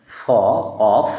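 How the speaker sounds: of spoken as "off"; teacher blending অ and ফ into of. Shown as a demonstration. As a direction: sound out ফ, then say of ending in f. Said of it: "এখানে লেখা আছে অ".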